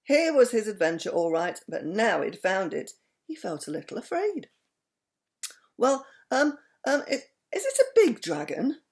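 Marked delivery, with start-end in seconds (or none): 5.8-8.8 hesitant, higher nervous voice. A narrator's reading. Mister Wumble asking the aeroplane man, nervous and hesitant. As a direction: stammer it out nervously. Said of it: "asked Mister Wumble nervously".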